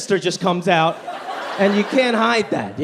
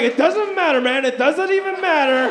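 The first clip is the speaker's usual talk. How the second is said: high, breezy put-on voice for the guy; high mock voice of a careless vet; mocking a dismissive old guy; 7 semitones higher than usual. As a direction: brush it off like a know-it-all. Voicing the dismissive veteran.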